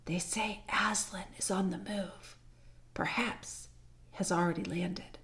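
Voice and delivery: soft, slow secretive whisper; low whisper. A narrator's reading. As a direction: hush it like a dangerous secret. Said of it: "it added in a low whisper".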